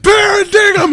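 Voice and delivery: very high, loud crowd chant; crowd shout. Retold or imitated. Crowd shouting band name.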